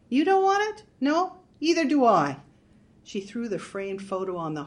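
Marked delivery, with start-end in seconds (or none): 0.1-2.4 high, loud and bitter. A narrator's reading. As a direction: spit it out bitterly. Marge voiced before stomping photo.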